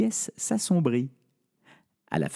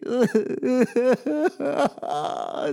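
high wailing sob of a spoilt princess; a princess bursting into tears again; 13 semitones higher than usual. Acted. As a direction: break into theatrical sobs. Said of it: "exploser à nouveau en sanglots".

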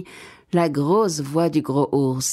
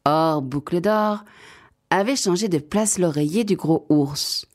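ordinary delivery, nothing marked

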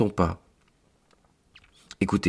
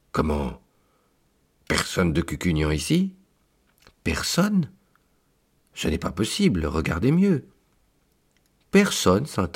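shocked disbelief. Acted. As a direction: gasp it out as if the floor dropped away. Priest aghast at empty page.

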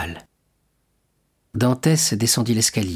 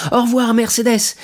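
much higher, louder shout; calling out; 14 semitones higher, 6 dB louder than usual. Acted. Dantès criant adieu à sa fiancée. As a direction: shout farewell from the carriage. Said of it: "par la portière et cria".

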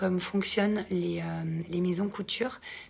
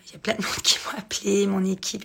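fast hoarse whisper; whispered through a lost voice; raspy whisper; 3 dB louder than usual. Real, not acted. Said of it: "je n'ai plus de voix".

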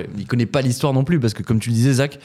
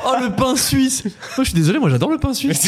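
excited protest; 14 semitones higher than usual. Real, not acted. Host defends loved pastry.

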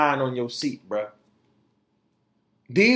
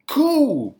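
sarcastic cool; 13 semitones higher, 6 dB louder than usual. Real, not acted. Sarcastic reaction to officials.

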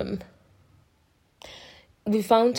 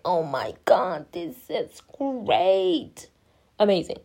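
drawn-out mock awe; 2 semitones lower, slower than usual. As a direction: savour every word in exaggerated wonder. Retells awe at good doctor.

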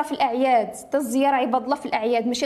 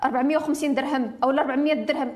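ordinary talk

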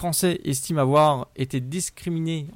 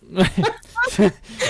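hearty laugh; 7 semitones higher, 5 dB louder than usual. Real, not acted. Laughs at topic drift.

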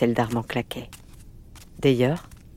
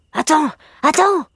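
high, urgent cry; excited boyish outburst; 13 semitones higher, 7 dB louder than usual. A narrator's reading. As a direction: call out to stop someone, high and loud. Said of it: "s'écria Armand".

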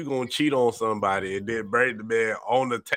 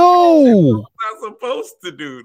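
high, loud, drawn-out imitated plea; mock protesting wail; 10 semitones higher, 11 dB louder than usual. Co-host mimicking the man's excuse to the others, mock protesting wail. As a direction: wail it out in exaggerated helplessness.

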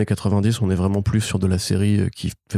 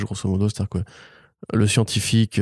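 ordinary talk, nothing marked

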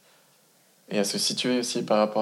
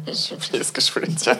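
embarrassed laugh through the words; 5 semitones higher than usual. Real, not acted. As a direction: laugh it off, a bit higher. Laughing at forgetting her point. (en riant, gênée)

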